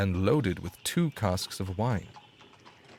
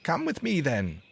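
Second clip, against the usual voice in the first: higher bird voice; sparrow; 8 semitones higher than usual. A narrator's reading. Sparrow leading the dog to bread, higher bird voice. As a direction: beckon as the sparrow. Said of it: "said the sparrow".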